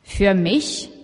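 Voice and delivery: halting, slow astonished stammer. A narrator's reading. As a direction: stammer it out in disbelief. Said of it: "stammelte sie".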